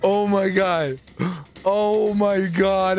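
usual speaking voice

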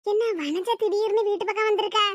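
usual speaking voice

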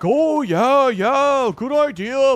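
mocking eager agreement. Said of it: "And then was like".

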